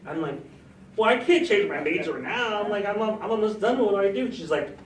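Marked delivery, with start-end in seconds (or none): 1.0-4.3 higher and louder, mock panicked; retold panic. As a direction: replay the panic of a junior with one year left. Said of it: "first I panicked".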